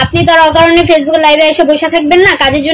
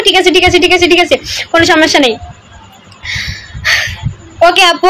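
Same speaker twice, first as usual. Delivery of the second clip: ordinary talk, nothing marked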